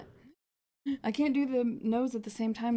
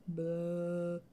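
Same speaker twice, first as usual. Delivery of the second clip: zombie groan; 3 semitones lower, 6 dB quieter than usual. Retold or imitated. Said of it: "all of a sudden you hear".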